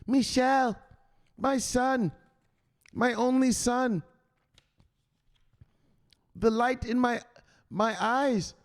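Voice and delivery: high, slow doting mom; pleading mother voice. Retold or imitated. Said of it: "his mama asks him".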